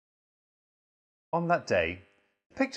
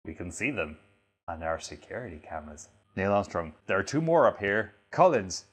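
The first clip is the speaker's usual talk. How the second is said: ordinary talk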